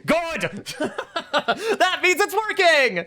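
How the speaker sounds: high, gleeful squeal. Real, not acted. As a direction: cackle with delight at the scare. Reader delights in scaring listeners.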